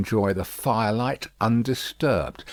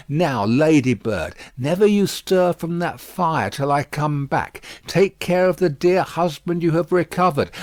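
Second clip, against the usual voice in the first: raised, brisk fussy maid; Miss Pross voice; 5 semitones higher, 5 dB louder than usual. A narrator's reading. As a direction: bustle through it, loud.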